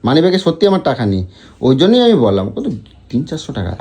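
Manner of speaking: ordinary talk, nothing marked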